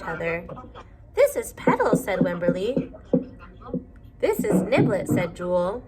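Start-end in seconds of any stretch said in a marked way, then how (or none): 1.1-1.9 put-on much higher, small child voice; a timid mouse showing off her doll; little Wimberly, pitched way up; shy little girl. 4.2-5.1 higher, small voice answering back; a new friend shyly showing her doll; shy second girl. Acted.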